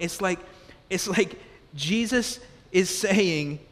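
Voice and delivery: usual speaking voice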